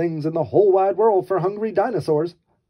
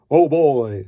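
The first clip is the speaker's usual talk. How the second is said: big booming papa voice; loud, booming dinosaur dad; 7 dB louder than usual. Acted. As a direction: boom it out like a huge dinosaur. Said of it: "said Papa Dinosaur in his loud, booming voice".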